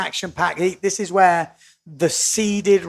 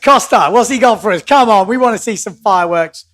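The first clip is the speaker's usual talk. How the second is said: excited; 3 semitones higher, 8 dB louder than usual. Real, not acted. Hyping the heat as it starts.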